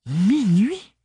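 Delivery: une question aiguë et stupéfaite; étonnée. A narrator's reading. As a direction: monter haut sur « Minuit », incrédule. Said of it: "s'étonna Ophélie". (Ophélie s'étonne au téléphone, voix aiguë surprise)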